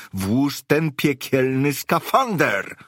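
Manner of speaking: high, loud, slow yell. Acted. Jones yells at Merli to put the suit on, loud and high. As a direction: bellow it at her, slow and furious. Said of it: "wrzasnął".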